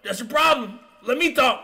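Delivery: high, loud argumentative patient; imitated yelling. Injured roommate yelling at his female counterpart, imitated. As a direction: mimic an injured man shouting her down. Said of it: "Rodney's yelling at some female counterpart".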